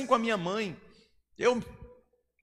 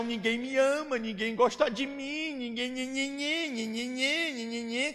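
mock self-pity whine; 4 semitones higher, 4 dB quieter than usual.

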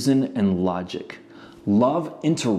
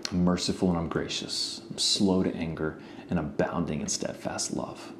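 gentle God; 4 semitones lower, 7 dB quieter than usual. Retold or imitated. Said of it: "God says".

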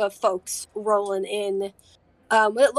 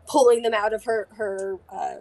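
ordinary talk

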